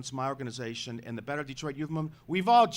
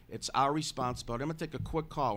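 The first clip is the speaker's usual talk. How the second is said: ordinary talk, nothing marked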